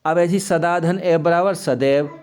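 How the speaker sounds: normal talking voice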